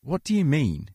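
high, baffled question; still confused. A narrator's reading. Volunteer still puzzled by the man's answer, high baffled question. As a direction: ask it puzzled, pitch shooting up. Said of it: "still confused".

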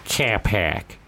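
put-on teasing Boston accent; mock Boston accent. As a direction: imitate an actor stuck on his Boston catchphrase. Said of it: "play someone from Boston".

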